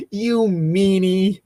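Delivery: pouty whine. Acted. Rose pouting at Harry in a slow, high childish whine. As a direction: drag out the insult like a sulky kid. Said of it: "Rose pouted".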